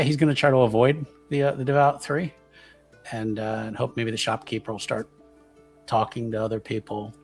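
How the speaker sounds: usual speaking voice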